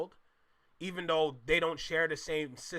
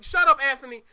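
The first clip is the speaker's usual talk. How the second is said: playful shout; 6 semitones higher, 9 dB louder than usual. Real, not acted. Calling out chat member Anthony.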